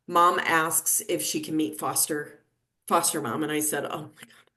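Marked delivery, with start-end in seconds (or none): 3.9-4.5 breathy unvoiced oh my God under the breath; whispered aside. Nurse stunned by mom's request, whispering under her breath. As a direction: mutter it so only you hear.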